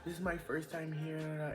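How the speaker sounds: high, soft tipsy gushing; lightly acted drunken squeal; imitating drunk excitement. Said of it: "I'm drunk, and I'm like".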